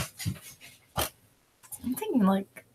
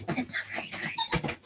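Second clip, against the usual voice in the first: high pet voice; 9 dB quieter than usual. Real, not acted.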